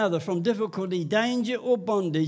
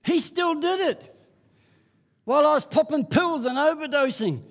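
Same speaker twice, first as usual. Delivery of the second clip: fervent preaching; 8 semitones higher than usual. Acted. Preacher to the congregation, high and fervent. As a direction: preach it out with full fire.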